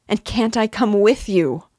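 high, loud desperate plea. Acted. Mother begging her son to take her along, loud. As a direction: throw the plea at him.

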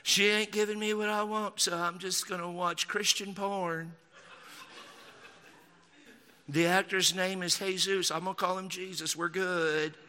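complaining Southern husband, hammed up; mocking Southern grumble; mock Southern accent. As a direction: put on a Southern good-old-boy voice. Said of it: "all of my mockery accents are from the South".

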